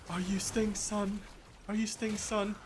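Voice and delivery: high, slow imitation of a child; little kid. Young fan asking at the elevator, imitated high kid voice. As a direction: put on an awed eight-year-old's high voice. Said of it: "this kid".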